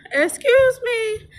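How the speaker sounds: high, drawn-out mock objection; mock indignant. Woman teasing the girl about her plans, mock indignant. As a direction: stretch it out high in playful protest.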